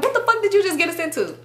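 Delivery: high-pitched imitation of alarm; mock panicked reproach. Driver blaming her after the getaway, high mock alarm. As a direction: mimic a freaked-out friend demanding answers.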